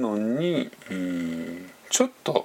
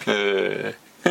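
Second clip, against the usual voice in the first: usual speaking voice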